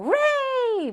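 high loud hooray; cheer. Shown as a demonstration. Teacher showing how Americans cheer, high and loud. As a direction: shout hooray like an American crowd. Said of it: "뭐 미국 같으면".

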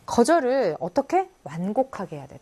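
normal talking voice

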